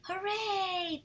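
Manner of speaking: ordinary talk, nothing marked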